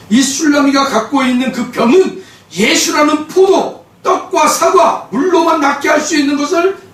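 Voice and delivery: high, loud, impassioned; fervent preaching. Real, not acted. Pastor urging congregation about the Shulammite, impassioned shout. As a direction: drive the point home, rising to a shout.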